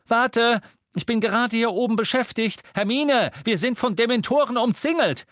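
high, loud, hurried shouts; frantic shouting. A narrator's reading. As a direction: shout back in a panic. Ron shouts under pressure.

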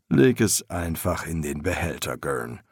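low master's voice, sinking at the end; weary master to his apprentice; master embalmer, weary. A narrator's reading. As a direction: tell him low and resigned.

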